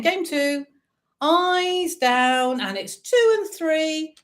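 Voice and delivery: sing-song bingo number calls; bingo caller. Acted. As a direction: call each number out like a bingo hall. Online bingo calling numbers.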